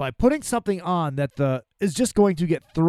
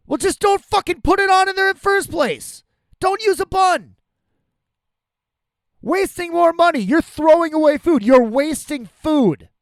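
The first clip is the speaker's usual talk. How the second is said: heated, high-pitched loud rant; worked-up anger; 10 semitones higher, 6 dB louder than usual. Real, not acted. Customer railing at a restaurant over a wasted bun, worked up. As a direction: raise your voice in exasperated protest. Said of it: "I'm so fucking worked up about this".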